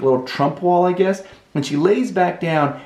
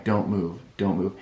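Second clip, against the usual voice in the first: low, soft and tense; quiet low urging; hushed self-warning; 6 semitones lower, 7 dB quieter than usual. Retold or imitated. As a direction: murmur it to yourself, keeping still. Lying still under her arm.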